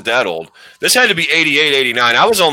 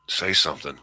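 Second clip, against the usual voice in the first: hushed whisper; 12 semitones lower, 10 dB quieter than usual. Retold or imitated. Whispering to friend in dark theater.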